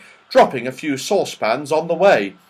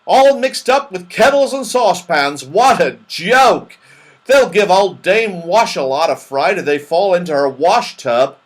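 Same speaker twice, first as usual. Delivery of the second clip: loud, high gleeful exclaiming; delighted; 8 semitones higher, 8 dB louder than usual. Acted. Moonface enjoying the joke of their fall, delighted. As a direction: relish the joke at full voice. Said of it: "said Moonface in delight".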